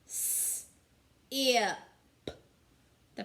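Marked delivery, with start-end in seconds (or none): 0.1-1.8 s whispered, then ip voiced; segmenting sounds. Shown as a demonstration. Teacher segmenting sip for young pupils, s hissed unvoiced. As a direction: split sip into s and ip. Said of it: "Tell me the sounds in sip".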